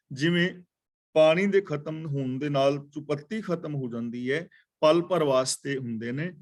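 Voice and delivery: ordinary talk, nothing marked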